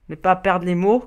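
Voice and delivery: ordinary delivery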